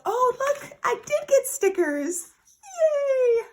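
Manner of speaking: high, loud burst of delight ending in a squealed yay; geeked out, pitched high and loud; super excited. Real, not acted. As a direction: squeal with real glee at a surprise freebie. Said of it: "so I'm super excited".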